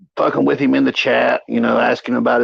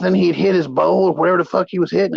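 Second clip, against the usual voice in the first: usual speaking voice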